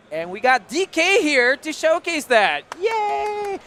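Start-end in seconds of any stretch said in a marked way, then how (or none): 2.8-3.6 high, loud happy cheer; excited cheer. Real, not acted. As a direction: cheer the guest in. Host cheers guest's arrival.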